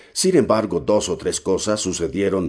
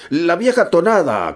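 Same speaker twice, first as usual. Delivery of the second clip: much higher, loud calling; second sailor joining the call; chorused shout; 11 semitones higher, 6 dB louder than usual. A narrator's reading. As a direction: cheer it out with the crew. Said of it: "coreó otra".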